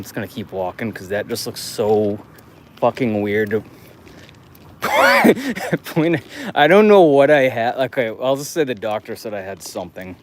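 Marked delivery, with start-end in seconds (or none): 4.8-5.8 bursts out laughing high; a sudden high laugh. Real, not acted. Vlogger laughing at himself, high burst.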